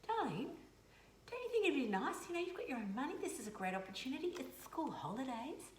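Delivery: put-on high, sugary mum voice coaxing her teen; sweet coaxing. Mother sweetly coaxing her sulky teenage daughter, high and sugary. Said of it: "I'm going".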